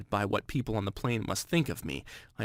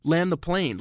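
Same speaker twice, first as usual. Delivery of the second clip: raised panicked yell; screaming; 5 semitones higher, 3 dB louder, slower than usual. Retold or imitated. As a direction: shout it out in panic. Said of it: "screaming at the top of my lungs".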